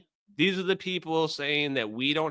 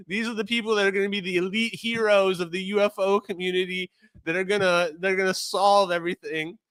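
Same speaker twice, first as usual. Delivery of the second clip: mock announcement; 7 semitones higher than usual. Mocking the alien disclosure people.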